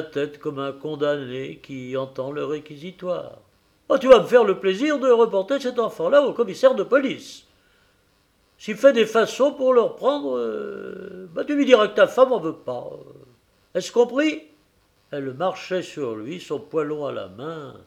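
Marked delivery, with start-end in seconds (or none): 3.9-14.4 high, loud, fast commands; angry orders. A narrator's reading. Wife ordering her husband to return the child, loud commands. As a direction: lay down the law.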